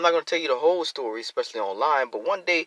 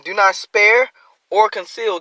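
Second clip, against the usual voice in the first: scripture reading; 6 semitones higher, 5 dB louder than usual. Acted. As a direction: draw out each word of the verse. Verse reading.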